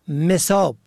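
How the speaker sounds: مصاب said "mesab"; contrasted first vowel. Shown as a demonstration. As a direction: say مصاب with a short e after m. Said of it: "حال فرق بین مصاب". (مصاب با کسرهٔ میم: مِصاب)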